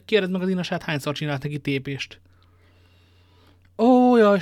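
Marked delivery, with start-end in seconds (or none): 3.8-4.4 high, loud woeful wail; lamenting sigh. Acted. Bazin laments his master's soldiering, loud woeful wail. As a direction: cry out in mock despair. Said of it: "sóhajtott Bazin".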